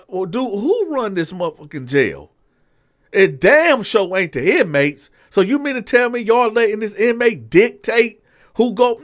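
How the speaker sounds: retold boss blowing up at his sergeant; indignant captain. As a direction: demand who runs this jail.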